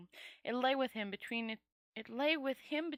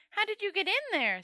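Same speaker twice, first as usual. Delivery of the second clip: surprised; 9 semitones higher, 4 dB louder, faster than usual. Acted. Startled question.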